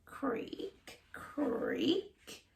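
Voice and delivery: soft creaky door noise; creaking door. A narrator's reading. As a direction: draw out the creak. Door creak sound effect.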